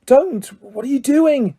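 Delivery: urgent, hushed alarm; hissed. A narrator's reading. Harry stopping Dobby, hissing urgently. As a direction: stop him without being heard. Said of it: "Harry hissed".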